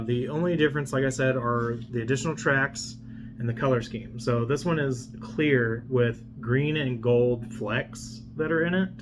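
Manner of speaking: ordinary talk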